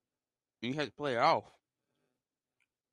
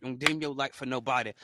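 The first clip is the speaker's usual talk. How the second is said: normal talking voice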